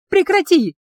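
high, loud frightened shriek. A narrator's reading. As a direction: shriek it out in panic. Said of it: "взвизгнула я".

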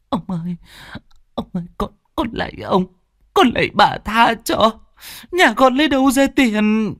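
high, tearful sobbing voice; crying plea. A narrator's reading. Servant bursts into tears begging the landlord. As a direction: beg through tears. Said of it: "òa khóc".

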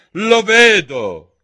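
high, very loud, slow; cutting retort. Acted. La regina interrompe il giardiniere, secca. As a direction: cut him off sharply. Queen cuts him off.

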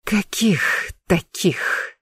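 slow rasping whisper, choked; hoarse whisper. Acted. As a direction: rasp it out through a squeezed throat. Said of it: "прохрипела я".

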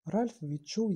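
usual speaking voice

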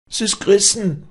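very high, loud terrified shriek; shrieking in fear. Acted. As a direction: shriek it as the rope snaps. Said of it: "kreischten die Leute". (panisches Kreischen)

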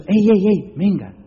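high, quick hailing; imitated calling-over. Library lad calls the customer back, imitated hailing. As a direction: mimic someone flagging you down.

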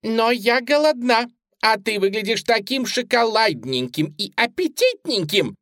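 put-on high, loud child's voice; high-pitched childish coaxing; little girl. A narrator's reading. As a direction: whine hungrily like a small girl. Little girl Suzy talks to bunny.

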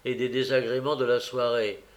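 ordinary delivery

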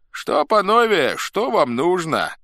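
high, slow, drawn-out question; questioning old Cossack. A narrator's reading. Elderly Cossack asks his visitors their business, slowly. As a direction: ask it slowly, feigning ignorance.

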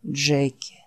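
low, soft, worn-out creature voice; barely has the strength to say the name; weary dying Wolf. Acted. Wounded Wolf calling his friend Jack, low and faint. Wolf's voice.

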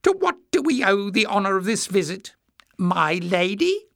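high, mock-courteous jeer; mock the visitor with high-voiced false courtesy. A narrator's reading. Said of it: "Jinx jeered".

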